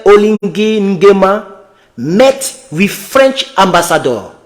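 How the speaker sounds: slow, careful reading of English text; slow English read-aloud. Real, not acted. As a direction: read each English word out slowly. Reads English article aloud slowly.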